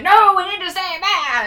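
high, loud mock rant; hammed-up outburst of a man refusing to go; losing his mind. Ghost hunter bitching at everyone who wants to leave, mock raging. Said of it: "loses his fucking mind".

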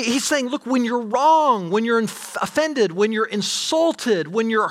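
high, urgent sermon delivery; plead, slowing on the key words; preacher urging forgiveness; impassioned preaching. Acted.

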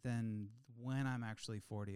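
ordinary talk

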